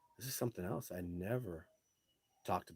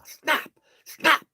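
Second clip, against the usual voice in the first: sharp, loud, high "Snap!" like a trap shutting; jaw snap imitation; 16 dB louder than usual. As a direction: bark out each "Snap!" like jaws clamping. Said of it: "I heard her jaw snap like a trap jaw".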